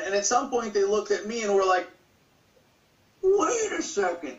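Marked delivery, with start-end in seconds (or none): 3.2-4.4 high, drawn-out "wait a second"; imitating a stunned objector, high and slow; mock objection. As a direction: stretch it out slowly in a higher pitch. First take, before trying the accent.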